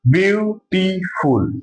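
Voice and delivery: Beautiful drawn out slowly, each syllable separated. Shown as a demonstration. Teacher counting syllables for his students, slow syllable by syllable. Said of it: "इसमें तीन syllable आ रहे हैं".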